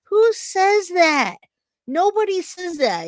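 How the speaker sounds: high, drawn-out exasperation; getting angry. Real, not acted. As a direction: stretch it out in heated, high-pitched disbelief. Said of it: "I'm getting angry at her".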